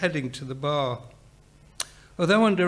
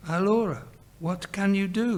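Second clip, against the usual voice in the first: ordinary delivery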